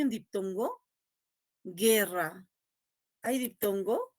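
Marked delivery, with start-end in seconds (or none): 1.6-2.4 "Guerra" as ge-rra, U not produced; silent U in guerra. Shown as a demonstration. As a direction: model "Guerra" slowly without any U. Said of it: "esa U en guerra no suena". ("Guerra" sin producir la U)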